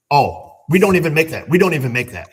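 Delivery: imitating the tech guy blurting it out gleefully; all excited. Napa tech guy boasting to the mechanic on the phone, mock excited. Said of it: "He's all excited".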